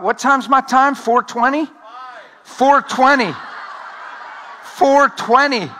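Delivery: high, loud mock outrage over the time; playful disbelief. Real, not acted. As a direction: ham up the joke about the slot. Joking about his time slot.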